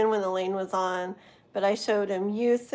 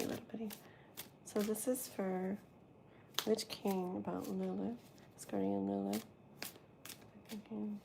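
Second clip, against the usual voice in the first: soft murmur; 16 dB quieter than usual. Acted. Quiet reading for a card pull.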